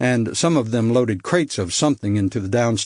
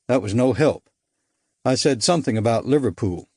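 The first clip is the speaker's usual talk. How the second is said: ordinary delivery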